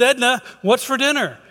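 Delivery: high-pitched, exasperated call; a little frustrated. Acted. Husband right beside wife after getting no answer, frustrated call. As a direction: call out, losing patience. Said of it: "he's getting a little frustrated at this point".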